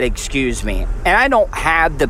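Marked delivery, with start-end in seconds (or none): 0.0-0.9 lower, drawn-down sarcastic "Excuse me"; mock the stranger's snide tone. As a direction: drip contempt into the politeness. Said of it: "very sarcastic when he said".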